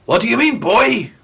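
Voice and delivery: put-on ghostly grandad in Indian Glaswegian accent. A narrator's reading. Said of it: "in an Indian accent fused with Glaswegian".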